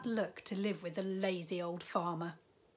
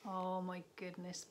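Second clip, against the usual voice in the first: plain reading voice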